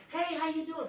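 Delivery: high, cheery hey how you doing; bright greeting to waitress. Retold or imitated. Preacher greeting a waitress, high cheery hello. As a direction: greet the waitress with a big lift. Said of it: "I'll go up to the waitress".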